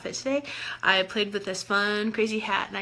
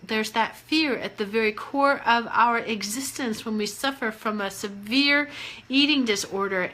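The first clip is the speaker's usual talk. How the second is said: ordinary talk, nothing marked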